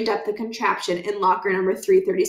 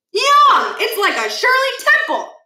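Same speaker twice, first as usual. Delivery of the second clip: very high loud happy yum; delighted yum; 14 semitones higher, 6 dB louder than usual. Acted. Stuart tasting the cherry, high and delighted. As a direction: squeal with delight.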